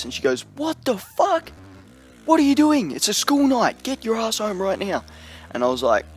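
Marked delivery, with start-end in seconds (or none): 0.6-5.0 much higher mocking mum voice, outraged; angry mum voice. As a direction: squeak it out like a furious mum imitation. Mum yelling on the phone.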